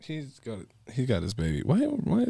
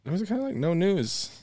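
pitched way up; high squeaky voice; 10 semitones higher than usual. Real, not acted. High-pitched stretched filler.

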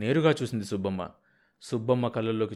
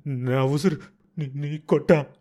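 put-on old woman's choked curse; 4 semitones higher, 4 dB louder, slower than usual. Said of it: "నాలుక మెలితిరిగిపోతుండగా శాపనార్థాలు".

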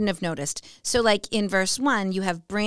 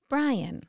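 "Brian" higher on BRI, dropping on an; trochaic stress; 3 semitones higher, 6 dB quieter than usual. Shown as a demonstration. Showing husband's name is trochaic, first-syllable stress. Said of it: "our names are trochaic".

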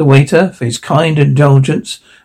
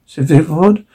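ordinary delivery

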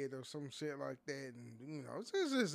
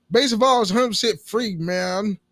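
loud, fast, hammed-up sales boast; oddly emphatic ad line; 6 semitones higher, 18 dB louder than usual. Podcaster selling a free app, over-the-top ad delivery. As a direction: punch out the offer like an overexcited pitchman. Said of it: "why I say it like that?".

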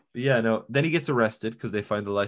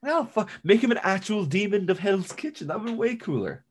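excited realization; 9 semitones higher than usual. Real, not acted. Realizing a great crossover idea.

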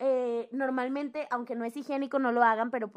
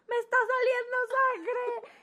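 put-on higher, trembling little-girl voice; whimpering child; 13 semitones higher, 3 dB louder than usual. Girl to the school nurse, mock whimper. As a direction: tell the nurse like a frightened kid. Telling nurse she bleeds.

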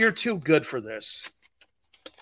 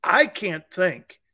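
ordinary delivery

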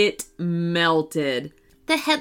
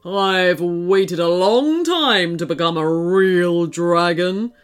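slow, savouring villain dragon; big storybook monster voice, slowly; gloating dragon; 3 semitones lower, 4 dB louder than usual. A narrator's reading. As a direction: draw it out with greedy relish. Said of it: "beamed the dragon".

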